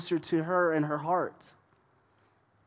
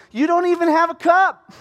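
high, loud, scoffing retort; shrill-free sassy jab, much higher and louder; feisty mock retort; 12 dB louder than usual. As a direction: sneer it at him like he's a fool. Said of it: "This lady is so feisty".

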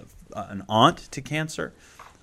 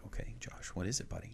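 hushed gentle dad; 16 dB quieter than usual. Retold or imitated. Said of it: "And I'm like".

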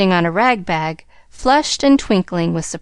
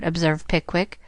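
ordinary delivery

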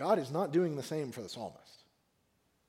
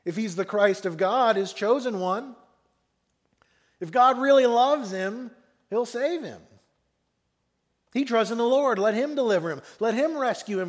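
raised, louder scoffing voice; 4 semitones higher, 6 dB louder than usual. Acted. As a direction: jeer like rulers mocking a victim. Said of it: "the rulers scoffed at him, saying".

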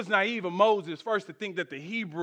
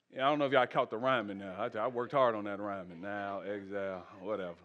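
much lower, softer, quick throwaway; self-mocking aside; 14 semitones lower, 10 dB quieter, faster than usual. Real, not acted. Preacher to congregation about his rhyme, quietly joking. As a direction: mumble it off with a shrug.

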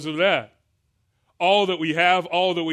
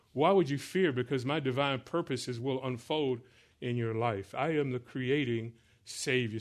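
hushed, tender preaching as God's voice; gentle reassurance; 3 semitones lower, 6 dB quieter than usual. Acted. Preacher speaks as God comforting the fearful, soft and tender. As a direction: soothe them softly, letting the pitch settle low.

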